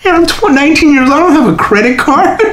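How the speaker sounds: high, laughing protest; exasperated young self. Retold or imitated. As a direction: protest helplessly, half laughing. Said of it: "I'm like".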